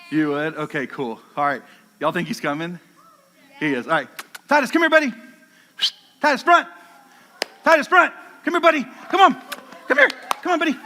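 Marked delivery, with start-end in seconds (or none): 4.5-10.8 high, loud, excited dog call. Real, not acted. Calling his dog on stage.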